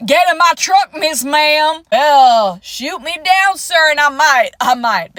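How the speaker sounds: loud, swaggering southern accent imitation; confident southern man. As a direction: ham up a cocky cowboy from the South. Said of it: "They will still have that".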